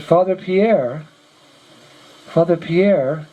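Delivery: slow, taken aback. Retold or imitated. Monk asked about a dead brother, slow and taken aback. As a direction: repeat the name slowly, as if taken aback.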